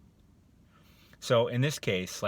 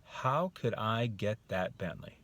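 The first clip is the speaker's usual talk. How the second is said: slow, thoughtful question; open wondering; slower than usual. Shown as a demonstration. Coach demonstrating the other one, thoughtful wondering. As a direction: ask with a little opening of belief. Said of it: "It's like thought".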